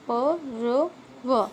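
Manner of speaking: ordinary delivery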